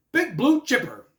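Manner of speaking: high, loud commentator catchphrase; imitating J R. Quoting J R on Matt Morgan, mock commentator voice. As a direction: punch it out like an excited commentator. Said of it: "J R says".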